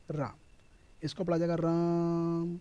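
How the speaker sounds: राम with a long, slow held vowel; राम stretched to three beats; prolonged Ram. Shown as a demonstration. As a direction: draw out राम as if calling someone. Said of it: "ये तीन मात्राएं लग गई".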